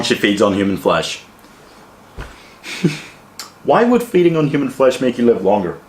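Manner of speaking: ordinary talk, nothing marked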